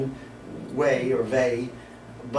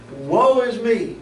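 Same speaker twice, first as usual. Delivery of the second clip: higher, louder, slowed lament; heart cry; 8 semitones higher, 7 dB louder, slower than usual. Acted. Preacher voicing Isaiah's cry of despair, anguished. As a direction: cry it out like a man undone. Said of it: "this is a heart cry".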